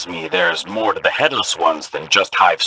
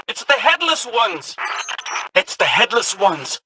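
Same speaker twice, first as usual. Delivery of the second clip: higher, thrilled exclamations; like a kid whose ghost story came true; excited; 8 semitones higher than usual. Acted. Ghost discovering the old stories are true, thrilled.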